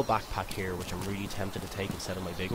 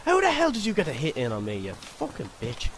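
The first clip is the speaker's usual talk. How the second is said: angry; 7 semitones higher, 5 dB louder than usual. Real, not acted. Attacked by zombies.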